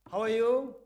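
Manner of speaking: called out loud into the box. Shown as a demonstration. Teacher calling into the box, loud. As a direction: shout the greeting in.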